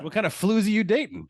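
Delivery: high, loud laughing outrage; outraged buddy voice. Retold or imitated. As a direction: accuse him, cracking up. Mock outraged friend voice.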